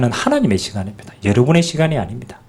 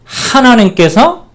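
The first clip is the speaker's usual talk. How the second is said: 하나님께서 said very slow, higher and louder; slow emphatic subject; 9 semitones higher, 4 dB louder, much slower than usual. Shown as a demonstration. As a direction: stretch out 하나님께서 to stress the subject. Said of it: "어떻게 바꿨냐면".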